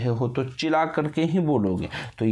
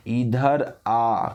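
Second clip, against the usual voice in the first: इधर आ very slow, आ held long; stretched distant call; much slower than usual. Shown as a demonstration. Teacher showing calling someone from afar, इधर आ prolonged. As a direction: draw out इ and आ in इधर आ. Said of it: "किसी को दूर से बुलाना है".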